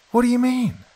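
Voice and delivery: high, loud bewildered question; startled and incredulous, pitch leaping up; astonished. A narrator's reading. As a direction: demand it in growing amazement. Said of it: "growing more and more astonished".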